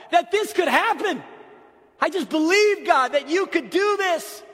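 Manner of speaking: slow, high, loud cry of faith; impassioned confidence. Acted. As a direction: proclaim it at full force, stretching words. Father's confident plea, preached at full force.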